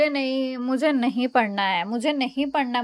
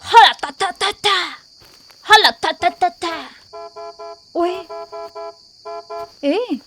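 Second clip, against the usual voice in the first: herding call; 5 dB louder, slower than usual. Acted. Calling the animals.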